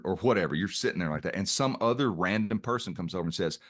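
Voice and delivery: ordinary talk, nothing marked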